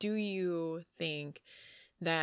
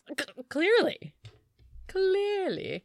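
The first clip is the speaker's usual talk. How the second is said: sarcastic; 12 semitones higher, 7 dB louder, slower than usual. Real, not acted. Mock agreement.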